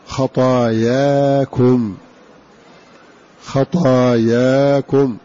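خطاياكم with long drawn-out "aa" after ط and after ي, very slow; alif reading. Shown as a demonstration. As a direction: recite خطاياكم slowly, stretching both long "aa" vowels. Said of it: "بألف بعد الطاء وبعد الياء على لفظ".

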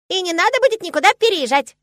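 very high, loud squeaky squirrel; excited squirrel. A narrator's reading. Squirrel glad nobody must move, excited squeak. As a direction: squeal it eagerly. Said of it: "закивала рыжая пушистость".

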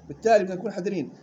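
ordinary talk, nothing marked

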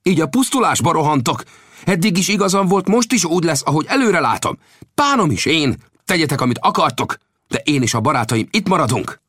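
high-pitched, indignant outburst; angry outburst. A narrator's reading. Old Death defies the arguing Comanche council, in an angry outburst. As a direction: flare up angrily, pitch jumping high from the first word. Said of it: "dühösen felugrott a helyéről, és hallottam, amint hangja felcsattan".

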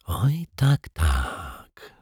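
detective whispering the echo in disbelief. A narrator's reading. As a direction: whisper it to yourself. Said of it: "szepnął detektyw Pozytywka".

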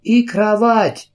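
high, slow call after them. A narrator's reading. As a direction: shout it after the footsteps. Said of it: "Чарльз крикнул вслед".

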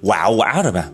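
ordinary delivery

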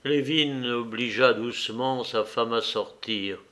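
ordinary delivery, nothing marked

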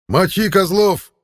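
high, slow shouted war cry; battle cry. Acted. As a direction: yell it as a dying warrior. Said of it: "успел ещё раз выкрикнуть противник".

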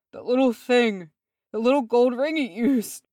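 high, strained wheeze; wheezing. A narrator's reading. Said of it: "he wheezed".

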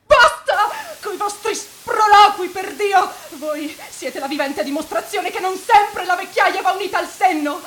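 furious outburst. Acted. Young man answering the priest, furious shout. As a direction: throw it back in his face.

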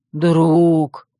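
heavy, drawn-out brute voice; primitive brute. A narrator's reading. Dep recognises Zemka, brutish drawl. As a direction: draw the one word out.

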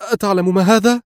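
high-pitched, urgent question; exclaimed question. A narrator's reading. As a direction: cry out the question, voice lifted. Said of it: "هتفت".

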